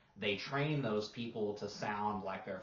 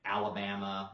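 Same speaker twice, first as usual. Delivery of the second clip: ordinary talk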